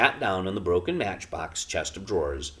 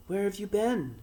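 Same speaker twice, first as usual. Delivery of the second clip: put-on raised, young boy's voice; a boy asking, unsure. A narrator's reading. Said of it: "asked the boy".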